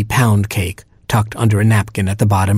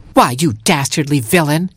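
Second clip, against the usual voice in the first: higher-pitched, outraged hero; indignant cry; 5 semitones higher than usual. Acted. Little hero accusing the trickster mockingbird, indignant high cry. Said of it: "he cried".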